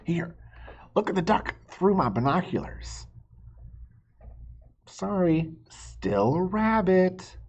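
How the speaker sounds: high, animated storybook voices; high read-aloud with a little hushed squabble; reading to children. Acted. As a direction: play both bickering friends for kids. Reading picture book, two voices.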